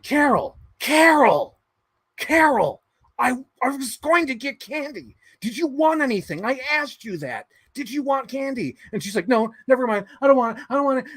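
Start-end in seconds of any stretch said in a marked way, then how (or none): 0.0-8.8 high pleading husband calling his wife; high, whiny calls after the wife about candy; husband at the movies begging his wife to answer, high and whiny. Retold or imitated. 9.3-11.1 high, huffy wife brushing him off; high and curt, she's done with him; wife at the movies brushing off her husband, high and huffy; annoyed wife. Retold or imitated. Wife snapping back at husband.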